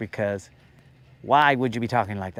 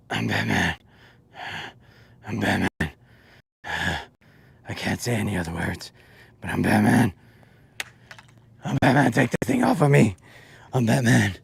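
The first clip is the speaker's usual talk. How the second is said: strained Batman; 6 semitones lower than usual. Retold or imitated. Imagining Batman in a tight suit, strained breathy voice. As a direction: talk like a suit's crushing your chest. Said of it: "his suit was so tight that he's all like".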